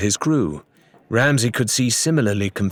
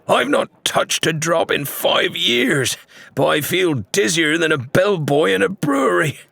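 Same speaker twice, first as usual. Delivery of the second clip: dizzy shipwright; 8 semitones higher than usual. A narrator's reading. Staggering shipwright tells the crowd he's dizzy, higher woozy voice. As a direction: sound befuddled and off balance. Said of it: "at every stagger and crash".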